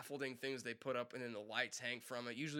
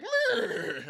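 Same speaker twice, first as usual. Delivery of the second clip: very high, loud laughing "meh"; bored horse; 12 dB louder than usual. Retold or imitated. Voicing his horse after the bird died, high and silly. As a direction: squeak out the horse's shrug.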